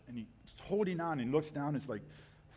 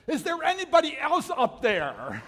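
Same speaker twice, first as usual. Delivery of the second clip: high, loud, slow yell with a laugh in it; climber punchline yell; 11 semitones higher, 10 dB louder than usual. Acted. Climber refusing to let go, yelling up for someone else, high and loud. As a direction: land the punchline as a desperate call.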